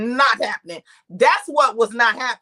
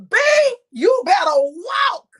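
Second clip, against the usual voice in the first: imitated crowd hype; 9 semitones higher, 7 dB louder than usual. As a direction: cheer on a runway walk, stretching it. Crowd cheering on the model walk.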